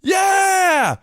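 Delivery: high, loud cheering shout; mock ringside cheer; excited yell. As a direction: whoop like a ringside fan. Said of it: "Crockett says".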